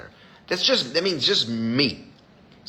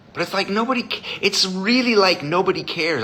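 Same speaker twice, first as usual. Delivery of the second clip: ordinary talk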